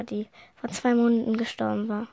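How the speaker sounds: ordinary delivery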